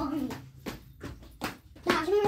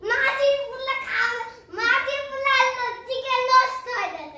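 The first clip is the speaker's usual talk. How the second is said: calling out loud; 6 semitones higher, 5 dB louder than usual. Real, not acted. Child calling to mother. (জোরে ডাকা)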